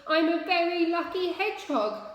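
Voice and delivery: high, loud joyful shout; exultant yell. A narrator's reading. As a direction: Max shouting with relief at the top of his voice. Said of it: "he shouted at the top of his voice".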